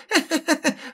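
high screeching cackle; shrill monstrous laugh. Acted. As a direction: cackle like a hungry ghoul. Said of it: "лицо визгливо расхохоталось".

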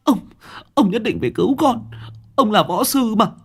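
a mother wailing for her son; sobbing high-pitched lament. Acted. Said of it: "ôm lấy ông hét toáng lên".

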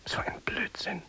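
whisper. Real, not acted. Whispered aside.

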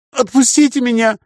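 high chirpy pleading voice; chirping creature. A narrator's reading. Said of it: "прощебетала тварина".

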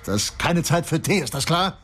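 detective cuts off the landlady's offer of tea with an outburst. Acted. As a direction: snap at her to stop offering tea. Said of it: "Durch seinen Ausbruch".

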